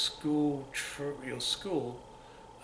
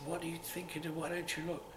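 usual speaking voice

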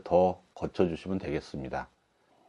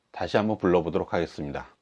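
usual speaking voice

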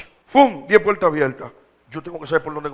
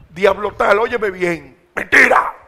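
face the devil and shout him down; commanding rebuke; 9 dB louder, slower than usual. Shown as a demonstration. Preacher confronting the devil in his living room, shouting. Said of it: "De tú a tú, hablarle".

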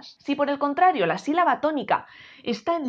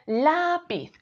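"lápiz" with LA drawn out; LÁÁ-piz; stress on first syllable; 4 semitones higher than usual. Shown as a demonstration. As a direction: hold and hit LA in "lápiz". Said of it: "La manera correcta de pronunciarlo".

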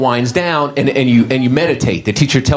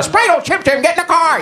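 much higher, slurred out of one side of the mouth; stroke mouth voice. Retold or imitated. Comic imitating a man with a crooked mouth, slurred. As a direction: talk out the side of a twisted mouth. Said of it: "that face that has a stroke when somebody talks outside their mouth".

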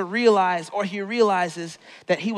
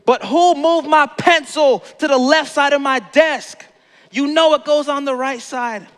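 raised, loud mock outrage; losing temper; 5 semitones higher, 5 dB louder than usual. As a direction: scold someone over a petty offence, hammed-up. Acted loss of temper over a pencil.

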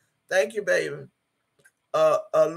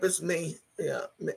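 ordinary talk

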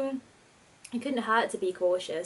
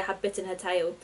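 ordinary delivery

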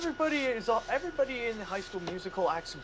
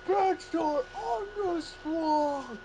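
cartoon character voice; 9 semitones higher, much slower than usual. SpongeBob scene quoted in character.

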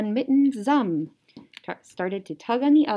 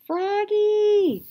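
long high louder call of Froggy; 13 semitones higher, 7 dB louder than usual. Acted. Mother calling Froggy back from outside, long high call. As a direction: call him from across the yard. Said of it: "called his mother".

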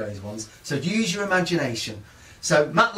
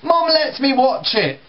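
higher, slow, louder whine; sulky kid; 10 semitones higher, 4 dB louder than usual. Retold or imitated. Boy answering dad back, slow and higher. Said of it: "Robert stands up".

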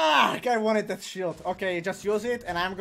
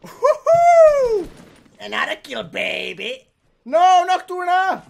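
excited whoop; 10 semitones higher, 11 dB louder, much slower than usual. Real, not acted. Celebrating kill then teammate dies.

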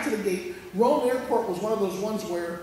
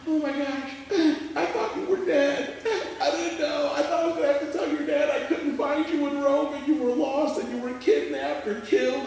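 relieved grandma; 7 semitones higher, 3 dB louder than usual. Retold or imitated.

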